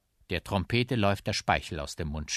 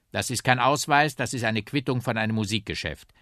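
louder, higher objection; officious policeman; 5 semitones higher, 7 dB louder than usual. Acted. Policeman examines the trumpet's crumpled receipt, loud officious objection. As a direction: reject it with official firmness.

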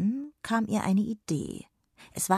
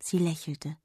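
ordinary delivery

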